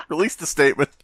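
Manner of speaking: words squeezed out through laughter, high; laughing. Real, not acted. Cohost laughing through a mock news line. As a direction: laugh while finishing the joke.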